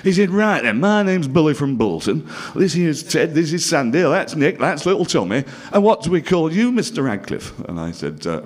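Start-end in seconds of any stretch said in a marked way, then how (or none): 0.3-7.6 hearty Bolton host introducing the team, a bit louder; a jolly northern entertainer doing introductions; Billy welcomes the new Bluecoat in a Bolton accent. Retold or imitated. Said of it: "and he talked like that".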